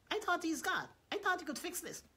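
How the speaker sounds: high, puzzled protest; incredulous. Retold or imitated. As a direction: ask in disbelief like the crowd. Imitates puzzled listeners.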